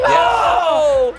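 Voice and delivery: booming shout of disbelief; sudden loud holler; loud cry. Real, not acted. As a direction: yell it out in the heat of the game. Reacting at the final moment.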